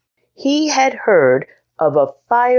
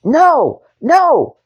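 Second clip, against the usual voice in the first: put-on high, loud childish protest; little knight refusing; boyish cry; 11 semitones higher, 4 dB louder than usual. A narrator's reading. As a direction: cry out like a frustrated boy. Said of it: "cried Henry".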